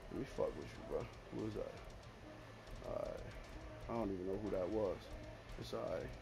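very quiet, low-pitched mumble to self; hushed, low, concentrating; low muttering. Real, not acted. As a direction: mutter it far under your breath. Muttering to himself while playing.

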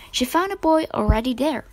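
plain reading voice

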